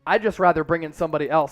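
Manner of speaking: ordinary talk, nothing marked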